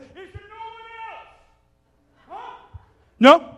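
high, mocking shout to the crowd; taunting challenge. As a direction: dare anyone else to step up. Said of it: "he's like".

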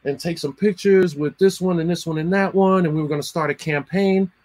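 airy and clueless, rushing the end; imitating the brand guy. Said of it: "he's like".

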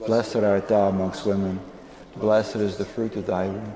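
normal talking voice

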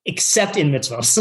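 usual speaking voice

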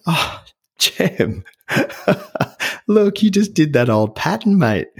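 high, amused self-scolding with a laugh; a rueful self-check, laughing at himself; catching himself. Retold or imitated. To himself after walking out, an amused startled self-rebuke. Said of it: "I caught myself and I went".

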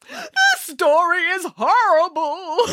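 high, slow tearful wail; weeping Boris. A narrator's reading. Boris weeps over the sad story, high and slow. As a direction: whimper it through tears. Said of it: "wept Boris".

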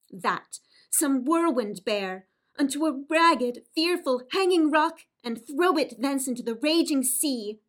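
loud angry curse at her own name; raging curse. Acted. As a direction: hurl the name onto the rocks.

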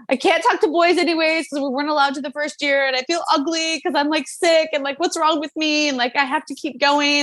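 put-on high, fretful voice of her teenage self; distressed younger self. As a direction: replay the worried thoughts of a scared girl. Retold anxious inner thoughts.

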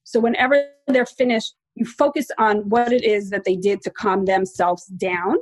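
ordinary talk, nothing marked

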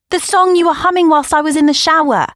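high, loud exasperated insistence; frustrated. Acted. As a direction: push back, fed up. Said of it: "I was officially frustrated".

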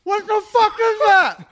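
loud, high shocked outburst; disgusted shout. Real, not acted. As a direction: yell it out in real disgust. Disgusted reaction after tasting.

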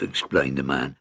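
usual speaking voice

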